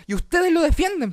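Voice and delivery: high-pitched, indignant mock yelling; re-enacted outburst at the director; retold shouting. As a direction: argue back as if yelling, pitch way up. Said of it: "igual le dije así como gritando".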